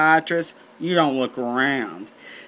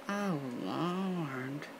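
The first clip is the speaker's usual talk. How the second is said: soft, drawn-out dread; very quiet, stunned reaction; hushed dismay; 13 dB quieter than usual. Retold or imitated. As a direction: breathe it out, appalled. Dismayed reply to grim news.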